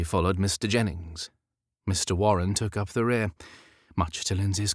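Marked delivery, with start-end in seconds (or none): none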